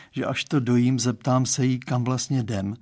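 usual speaking voice

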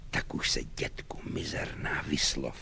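very soft impatient whisper; hushed, voiceless aside to oneself; whispered inner thought. Acted. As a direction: whisper it under your breath. Lieutenant's impatient silent thought.

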